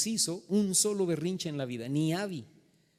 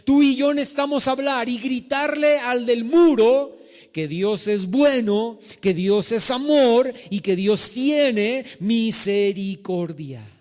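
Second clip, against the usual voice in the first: loud, drawn-out sermon cadence; full-force preaching; 6 semitones higher, 8 dB louder, slower than usual. Acted. Pastor proclaiming God's mercy to the congregation, emphatic preaching. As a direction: preach it, stretching the key words.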